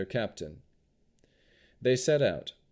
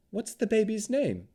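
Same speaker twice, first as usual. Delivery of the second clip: hiding concern; 13 semitones higher than usual. A narrator's reading. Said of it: "trying to hide her concern".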